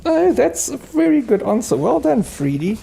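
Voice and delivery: "Oh, that's a very good answer" squealed up very high; gushing praise. Real, not acted. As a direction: gush over the pup, voice soaring.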